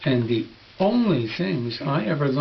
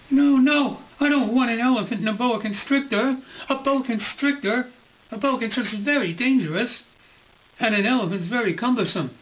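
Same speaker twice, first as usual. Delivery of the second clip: little prince protesting; 7 semitones higher, faster than usual. Acted. Little prince objecting to the boa drawing, high and fast. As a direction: rattle it off in a high child's protest.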